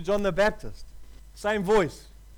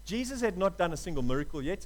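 usual speaking voice

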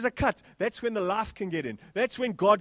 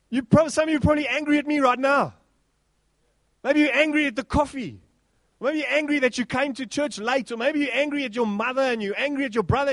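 preaching; 4 semitones higher, 4 dB louder than usual. Acted. Preacher drives home easily angered, louder. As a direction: drive the point home to the church.

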